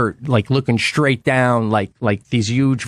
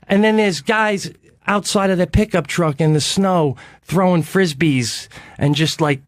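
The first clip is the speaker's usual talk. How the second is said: usual speaking voice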